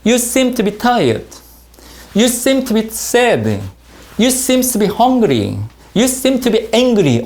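very slow, even drill reading; slow model reading. Shown as a demonstration. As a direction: read each sentence slowly for students to repeat. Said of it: "자". (천천히 또박또박 읽는 시범)

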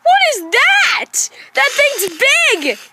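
high, slow frightened gasp; startled at something big in the dark; frightened cry. Acted. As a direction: voice jumping up in fright.

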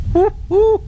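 two high, loud whoops; excited woo. Real, not acted. Preacher delighting in God's promise, high whooping. Exclaims while reading scripture.

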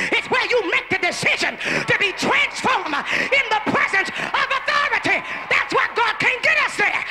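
rising whooped sermon cadence; preaching at full force. Acted. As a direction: preach it up to a wailing peak. Preacher building to a climax.

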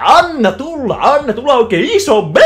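very high, loud cartoon dad greeting; hammed-up cartoon dad call; Goofy voice. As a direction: belt it out like goofy Hessu. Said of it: "Hessu on töissä".

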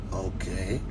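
very low, hushed "okay"; dry reaction okay. Acted. Reacting to some man's boast of being British, low muted reaction. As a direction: drop right down into a quiet reaction. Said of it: "And you be like".

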